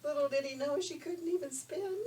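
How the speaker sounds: usual speaking voice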